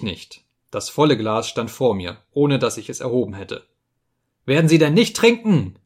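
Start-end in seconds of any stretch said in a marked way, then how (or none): 4.5-5.8 high, loud, threatening demand; impatient roar. A narrator's reading. Trudoljubo demanding the narrator drink, impatient roar. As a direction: roar it as patience snaps. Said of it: "brüllte Trudoljubo, der die Geduld verloren hatte".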